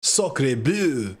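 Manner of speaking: over-the-top French exclamation; hammy French accent; french chef. Pretend. Mocking the French.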